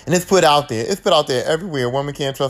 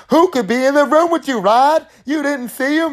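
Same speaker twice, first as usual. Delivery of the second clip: urgent Nancy; 8 semitones higher than usual. Retold or imitated. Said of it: "Nancy's like".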